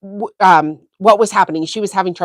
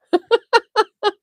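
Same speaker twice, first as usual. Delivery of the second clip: high burst of laughter; 13 semitones higher than usual. Real, not acted. Laughing at her own joke.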